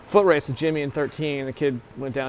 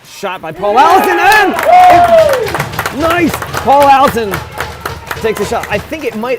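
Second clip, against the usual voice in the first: much louder and higher, shouting nice; excited goal call; 11 semitones higher, 12 dB louder than usual. Real, not acted. Commentator yelling as Paul Allison scores. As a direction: yell the goal like a thrilled fan.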